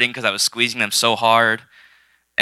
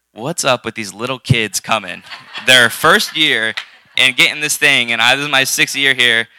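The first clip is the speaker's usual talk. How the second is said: exasperated complaint, rising higher and louder; retold indignation; 4 semitones higher, 5 dB louder than usual. Speaker voicing her private thoughts on younger kids, retold indignation. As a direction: build into a worked-up, envious grumble.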